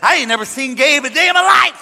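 loud, high-pitched mock disavowal; comic full-voiced protest; shouted denial. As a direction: belt out a hammed-up denial. Loudly disowns him before police.

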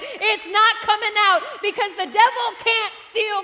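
high, loud, fervent preaching; full-force preaching. Acted. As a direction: preach it with fire.

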